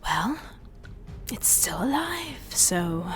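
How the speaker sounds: soft, breathy grim remark; resigned whisper. Acted. Kalei eyeing the Behir, resigned and whispering. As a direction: shrug it off in a whisper. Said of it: "and says".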